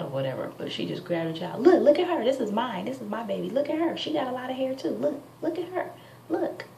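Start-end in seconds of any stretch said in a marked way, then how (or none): 1.6-6.6 eager neighbor. Retold or imitated. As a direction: push the baby into view proudly. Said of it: "she comes back".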